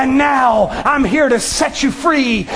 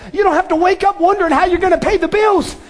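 normal talking voice

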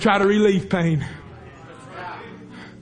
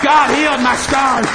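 booming higher, slower, louder repeat; preaching shout; 3 semitones higher, 4 dB louder than usual. Real, not acted.

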